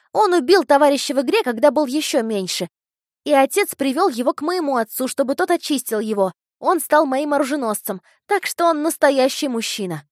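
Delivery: put-on high, loud boyish chatter; eager boy voice. A narrator's reading. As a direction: brag like an eager child. Narrator voices eager boy Achilles.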